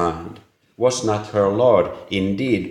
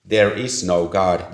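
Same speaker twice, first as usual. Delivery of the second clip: usual speaking voice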